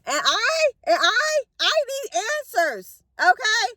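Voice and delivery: high, crying plea for answers; voice breaking. Retold or imitated. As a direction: crack into tears begging answers. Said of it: "This was Job's feeling".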